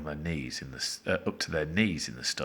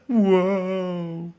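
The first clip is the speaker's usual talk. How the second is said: long, high loud howl; drawn-out anguished wail; despairing howl; 13 semitones higher, 8 dB louder than usual. A narrator's reading. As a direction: howl it out in misery. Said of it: "howled in despair".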